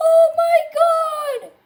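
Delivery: high, loud, drawn-out cry; excited shriek. Real, not acted. Streamer first sees the new update, excited shriek. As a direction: shriek it slowly in amazement. Update has just appeared.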